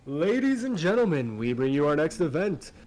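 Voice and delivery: booming high announcer voice, loud and showy; arena announcer. Acted. Arena announcer addressing the crowd, booming and showy. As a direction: ring announcer hyping the crowd to the fights. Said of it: "And he begins".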